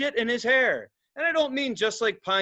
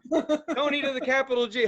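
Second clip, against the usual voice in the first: normal talking voice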